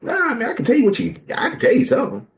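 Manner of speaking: high, loud, laughing incredulity; laughing disbelief. Real, not acted. Host laughs at a guest's six-month marriage, high and loud. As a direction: crack up at the absurd answer.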